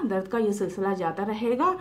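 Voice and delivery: ordinary talk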